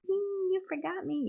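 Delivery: high, slow squeaky taunt; mock taunting voice. Talking to a crayon at work, in a high mocking voice. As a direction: tease the marker in a silly high voice.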